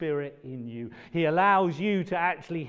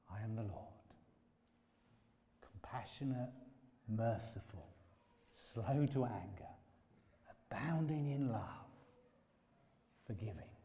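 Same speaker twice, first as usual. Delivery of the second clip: soft, tender reading of the Lord's words; quiet tender quote; gentle voice; 3 semitones lower, 10 dB quieter than usual. Real, not acted. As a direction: speak it gently, like God's whisper. Quoting God softly.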